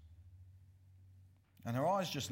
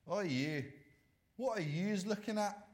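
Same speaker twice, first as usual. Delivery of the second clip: put-on higher-pitched challenge in a Scottish accent; aggressive Scottish accent; 6 semitones higher than usual. Stranger confronting a staring kid, aggressive Scottish accent. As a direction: mimic a confrontational Scot. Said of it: "someone quite aggressively saying to me, maybe in a Scottish accent".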